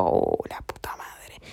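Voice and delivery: slow whispered swear under the breath; muttered curse. Retold or imitated. (maldición susurrada y lenta)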